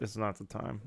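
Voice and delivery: very soft, resigned murmur; quietly defeated; deflated aside. Retold or imitated. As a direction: drop to a small, let-down voice. Acted disappointment, punchline.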